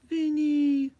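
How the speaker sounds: high sing-song pet call; cooing the dog's name. Real, not acted. Owner calling her dog on her lap, high sing-song. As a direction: coo his name up high.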